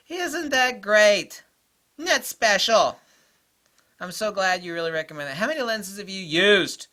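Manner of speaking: mock him with syrupy sarcasm; a loud, high mock-sweet put-down; sarcastic mockery. Mocking a lens know-it-all.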